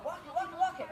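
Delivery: anxious, rushing cue; urgent cue. Shown as a demonstration. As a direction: sound worried the dog will run off. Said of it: "One sounds like, "Oh my God".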